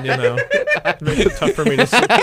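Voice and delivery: high, laughing through the words. Real, not acted. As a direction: crack up while teasing. Joking tease, voice rising.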